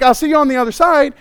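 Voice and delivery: high, loud, tossed-off goodbye; Jesus, breezy. Acted. Jesus to the men in the boat, breezy call. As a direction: call it out casually and quickly. Casual Jesus line re-acted.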